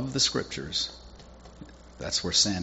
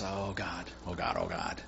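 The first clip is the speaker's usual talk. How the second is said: hushed pleading prayer; 4 semitones lower, 9 dB quieter than usual. Acted. Elijah cries out in prayer.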